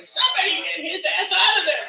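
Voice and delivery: laughing imitation of grandma shouting; mock grandma yell. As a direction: bark the order like a fed-up grandma. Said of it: "Grandma was like".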